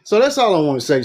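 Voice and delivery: breathless after a rant; out of breath. Real, not acted. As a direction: talk while winded. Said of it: "I'm getting out of breath".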